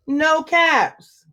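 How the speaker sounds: usual speaking voice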